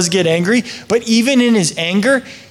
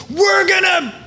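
much higher, mock shout of an impatient person; mock hurried human; 12 semitones higher, slower than usual. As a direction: shout it out in a rush.

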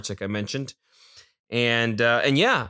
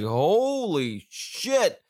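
amazed; 6 semitones higher, much slower than usual. Retold or imitated. Retold amazed reaction.

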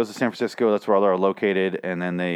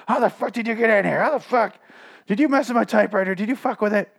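much higher, put-on voice, angry demands; furious outburst; 11 semitones higher than usual. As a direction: imitate a frantic woman finding an intruder. She confronts Warhol in her room.

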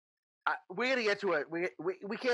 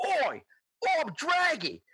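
badly faked accent, pitched way up; bad Rune imitation; 7 semitones higher, slower than usual. Kobold head puppet boasting to new company, in badly imitated accent. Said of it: "it's a really bad imitation of Rune".

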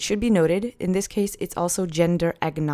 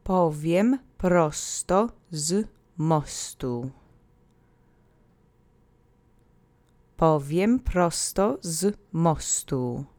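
"Powiem prosto z mostu" said very slowly, each word separate; slow model phrase; much slower than usual. Shown as a demonstration. Teacher saying the full phrase slowly for listeners to repeat. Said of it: "Repeat after me three times".

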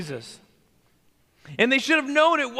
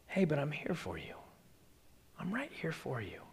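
soft, hushed and much quieter; tender reassurance; 7 semitones lower, 16 dB quieter than usual. Acted. Parent hushing a worried child, soft tender reassurance. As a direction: lean in close and reassure gently.

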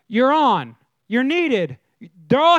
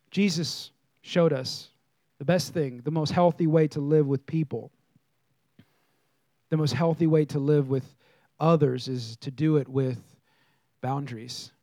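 hushed preaching; 8 semitones lower, 4 dB quieter than usual. Acted. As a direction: lean in and preach it quietly. Preacher drops into soft intense delivery.

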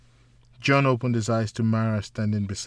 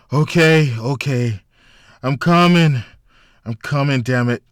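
groggy, louder, irritated calling; 4 semitones higher, 10 dB louder than usual. A narrator's reading. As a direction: call out half-awake to the phone. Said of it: "in a groggy voice".

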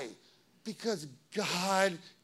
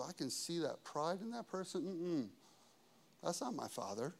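low, hushed self-assurance; quiet discernment; 5 semitones lower, 11 dB quieter than usual. Acted. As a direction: softly reason it out to yourself. Voices calm private refusal.

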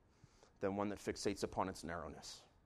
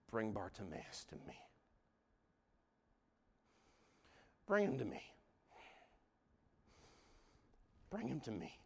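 soft command; 9 dB quieter than usual. Acted. Jesus summons quietly.

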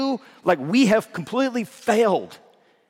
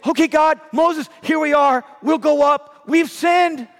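higher, louder pleading cry of the people; high, loud desperate plea ending in a shout; desperate people's cry; 7 semitones higher, 4 dB louder than usual. Retold or imitated. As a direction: beg Moses to let them go up now. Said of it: "and they say".

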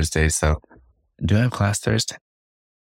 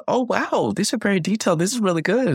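raised-pitch imitation of her gushing praise; excited friend quote; 14 semitones higher than usual. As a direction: mimic her amazed compliments, pitched up. Quoting her amazed praise.

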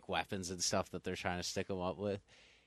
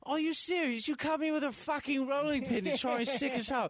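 mock furious crime boss. Unstable twin mocking a rolling pin, hammed-up rage. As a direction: go off at him in outrage. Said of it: "and he goes".

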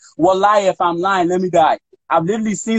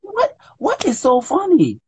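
mock indignant question; 10 semitones higher, slower than usual. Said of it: "And I be like".

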